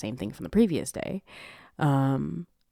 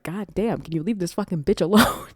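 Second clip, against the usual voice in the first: loud, higher exasperated viewer; exasperated outburst; 5 semitones higher, 6 dB louder than usual. Retold or imitated. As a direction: act out being fed up. Viewer's exasperated reaction to the film.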